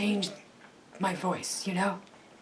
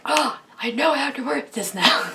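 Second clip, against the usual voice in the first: higher, louder burst of realisation; mock sudden realisation; 5 semitones higher, 7 dB louder than usual. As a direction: exclaim it as if it just clicked. Acting out belated realisation after filming.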